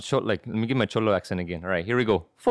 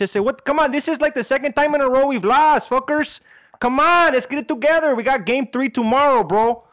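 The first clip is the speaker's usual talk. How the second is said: exasperated locker-room rant, pitched way up and loud; 11 semitones higher, 5 dB louder than usual. Acted. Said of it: "let's pretend I'm a sports guy".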